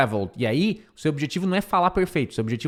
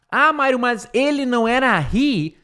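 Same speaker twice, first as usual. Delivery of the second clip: higher, puzzled student voice; imitating a student; 9 semitones higher, 4 dB louder than usual. Teacher voicing a puzzled student's objection, higher and questioning.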